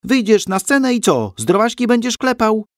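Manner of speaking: high, loud jeering question; mocking boom. A narrator's reading. Hans loudly mocking Krzysiek before the play. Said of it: "huknął mu prosto do ucha".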